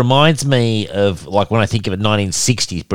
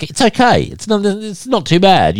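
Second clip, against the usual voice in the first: high, half-hearted shrug of a voice; lightly mocking "it's okay" in a high voice; mock lukewarm verdict; 12 semitones higher than usual. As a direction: say it like it's merely fine, pitched way up. Quoting lukewarm group verdict.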